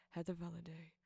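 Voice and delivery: ordinary delivery, nothing marked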